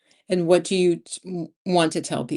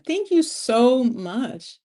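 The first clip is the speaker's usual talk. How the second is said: slow heartfelt thanks; 6 semitones higher, much slower than usual. Real, not acted. Warm thanks to the chat.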